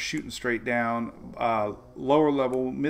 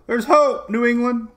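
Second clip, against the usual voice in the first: loud and high, thrilled; excited; 12 semitones higher, 10 dB louder than usual. Real, not acted. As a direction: announce the good news eagerly. Excited forecast for viewers.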